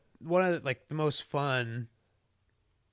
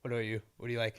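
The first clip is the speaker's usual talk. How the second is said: normal talking voice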